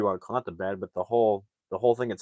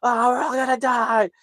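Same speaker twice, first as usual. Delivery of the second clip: mock terrified yelling; 3 dB louder than usual. Narrator as kids in spinning car, mock terrified yelling. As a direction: wail like doomed passengers. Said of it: "they're, like, yelling".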